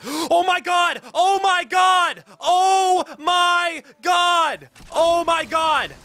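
gasp, then scream it high and loud in disbelief; real shock of joy, yelling over and over; ecstatic screaming. Real, not acted. Knife unboxed after long wait.

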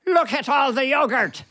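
put-on high-pitched, awestruck Irish accent; hammed-up Irish astonishment; amazed Irish relatives. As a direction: gawp in wonder as a humble Irish visitor. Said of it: "my wife's aunt and uncle".

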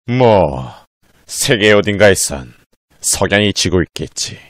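drawling gunslinger. Acted. McCree voice lines.

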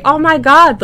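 loud, high mock crowd scream; high, loud retold freak-out. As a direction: imitate an onlooker shrieking with excitement. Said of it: "screaming and freaking out".

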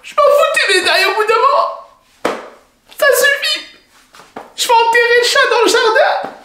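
high, loud angry yell; furious shouting. Acted. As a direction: blow up at the husband. Wife's furious outburst.

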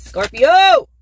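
loud, high call-out; excited. Real, not acted. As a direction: belt out the name with real excitement. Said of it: "sorry, I'm getting excited".

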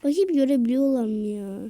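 ordinary talk

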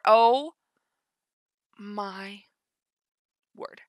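ordinary delivery

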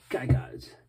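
kijk uit read softly, nearly whispered; quiet warning. Shown as a demonstration. Reading a fan's letter aloud, a soft whispered kijk uit. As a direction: breathe the kijk uit under your breath. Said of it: "Beste koning Vincent, van Ilse".

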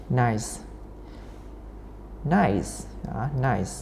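"Nice" said softly, its vowel gliding toward i; vowel demonstration. Shown as a demonstration. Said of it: "nó sẽ chữ y này nè".

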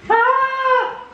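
high, drawn-out scream of hype; excited scream. Real, not acted. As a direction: let out a high fan's scream. Hyped scream after goal.

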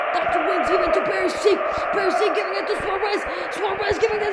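rapid excited match commentary; play-by-play commentary. Real, not acted. Gamer commentating passes toward a shot, excited play-by-play. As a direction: chain the passes together, building in pitch.

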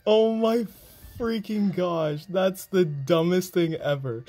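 high, slow, emphatic exasperation; exasperated outburst. Real, not acted. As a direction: drag out each word in outrage. Ranting at the game.

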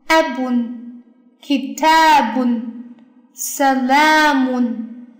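abun, kitabun, salamun slowly, hitting A, TA, LA; model reading, stressed syllables. Shown as a demonstration. Teacher rereading examples to learners, slow stressed syllables. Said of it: "把这个例子给大家读一下".

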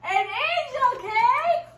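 high, slow delighted question; surprised. Acted. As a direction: marvel at it like a child. Mom's delighted mock surprise.

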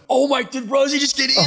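high, loud horrified outburst; reenacted freaked-out cry; acted panic. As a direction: blurt it in alarm. Acts out panic on reef.

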